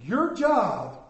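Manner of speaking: preacher calling the church to remember their own struggle; preaching. Acted. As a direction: drive the warning home to the congregation. Preaching to the congregation.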